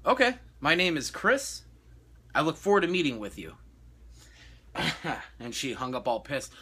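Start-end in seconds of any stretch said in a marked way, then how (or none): 0.0-3.6 overly cheerful agent voice; fake-polite brush-off. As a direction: sweetly dismiss her threat. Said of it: "and I'm like".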